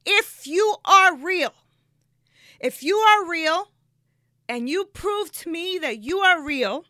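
loud, high, slow challenge to God; impassioned plea. Retold or imitated. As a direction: plead forcefully, weighing each word. Desperate bargain with God, retold.

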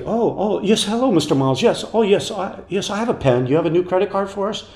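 mock-flustered old lady on the phone; flustered old woman. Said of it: "And it's like".

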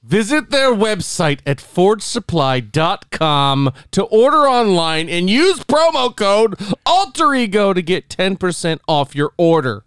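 slow, drawn-out commercial voice; ad read. Acted. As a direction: sell the soaps like a radio spot. S0 reads the sponsor ad.

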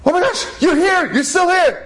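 very high hammed-up scream; mock panicked shriek. Revived boy seeing the Rav still there, mock panicked shriek. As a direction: shriek in acted shock.

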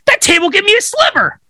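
high, fast complaining wife; wife tattling on the table; whiny wife. Acted. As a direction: whine it up high and quick.

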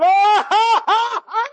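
loud, high hammed-up laughter; imitated laughing along. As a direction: burst into big laughter, playing along. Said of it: "You laugh along with him".

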